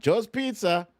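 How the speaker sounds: chirpy answer as if picking up at a pizza shop; mock shop phone greeting. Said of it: "so I'd be like".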